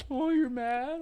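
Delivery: very high-pitched mocking baby-talk question; teasing. Man keeps teasing the angry girl, mocking. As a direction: needle her in a squeaky put-on voice. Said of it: "I started, like, making".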